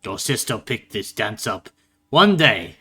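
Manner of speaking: scolding father, rising in force; gruff father. Acted. Father compares child to the sister, gruff scolding. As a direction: lecture with loud, high bursts of exasperation. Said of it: "you would hear a gruff voice go".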